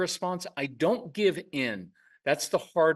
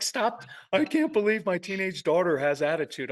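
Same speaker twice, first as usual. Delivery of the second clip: exasperated parent; 5 semitones higher than usual. Retold or imitated. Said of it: "it's like".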